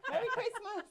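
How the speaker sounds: high, loud greeting through laughter; laughing mock greeting. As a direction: deliver a festive punchline, cracking up. Punchline of morbid card, laughing.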